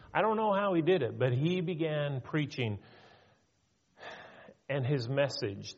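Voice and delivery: ordinary talk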